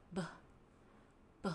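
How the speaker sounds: "B" said short with the voice buzzing; voiced b demo. Shown as a demonstration. Teacher shows students a voiced b. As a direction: buzz "b" so the throat vibrates. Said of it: "pronounce b kayak yang diucapin di situ".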